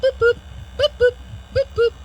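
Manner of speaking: high-pitched double beeps; short high boops, like a heart monitor; imitated monitor beeps. Patient mimics the movie sound of a heart returning, high beeps. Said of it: "just like in the movies, you hear boop".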